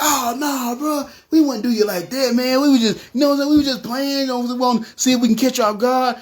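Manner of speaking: high-pitched, pleading mock denial; protesting innocence. Robber denying the plan to his intended victim, protesting. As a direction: plead it high, like someone caught out.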